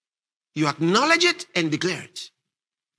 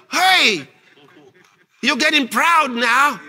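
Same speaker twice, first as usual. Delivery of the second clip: raised, higher-pitched call-out; mock accusation; 5 semitones higher, 3 dB louder, slower than usual. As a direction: call out a proud person, hammed-up. Acting out accusing bystanders.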